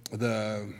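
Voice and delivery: normal talking voice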